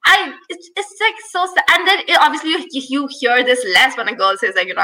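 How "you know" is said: ordinary delivery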